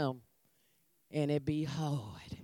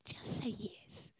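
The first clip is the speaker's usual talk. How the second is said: whispered just say yes; 17 dB quieter than usual. Shown as a demonstration. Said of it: "how you gonna do it".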